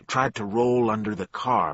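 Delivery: usual speaking voice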